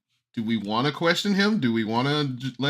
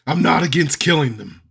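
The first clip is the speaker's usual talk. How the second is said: a little louder, flat menace; repeated deadpan threat; 5 semitones higher, 5 dB louder than usual. Acted. Mercenary repeating the threat to the party, louder and deadpan. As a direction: say it again for the joke. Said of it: "what did he say".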